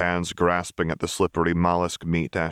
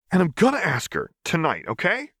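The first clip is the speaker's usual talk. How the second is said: put-on defensive kid; 12 semitones higher than usual. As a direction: snap back defensively. Milo defensive.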